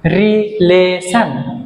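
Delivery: Relation in three beats, রি, লে, শন; slow relation with short gaps; syllable split. Shown as a demonstration. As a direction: separate each syllable of relation. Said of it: "তিনটা syllable".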